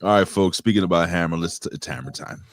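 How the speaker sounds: host announcer. Acted. Hosting segment intro.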